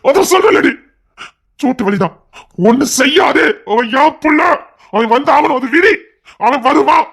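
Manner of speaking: high-pitched, agitated outburst; vehement insistence. Acted. Husband insists child will come.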